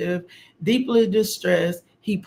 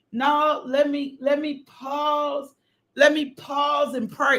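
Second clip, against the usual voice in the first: high, pitched-up preaching cry; preaching at full force; 8 semitones higher, slower than usual. Real, not acted. Preacher rousing the congregation about pausing to pray, high ringing preaching.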